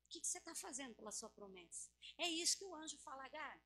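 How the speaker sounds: ordinary talk, nothing marked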